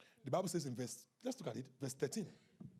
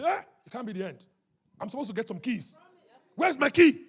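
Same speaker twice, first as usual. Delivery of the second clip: raised, insistent voice building to a shout; indignant demand; 6 semitones higher, 13 dB louder than usual. Acted. Preacher acts out someone owed keys, indignant shout. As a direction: demand it loudly, ending in a full shout.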